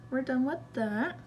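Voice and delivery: ordinary talk, nothing marked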